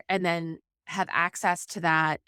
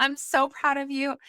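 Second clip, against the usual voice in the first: high, excited gush of praise; gushing pride; 7 semitones higher than usual. Retold or imitated. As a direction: burst with pride as if inwardly cheering. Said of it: "in my head, I'm like".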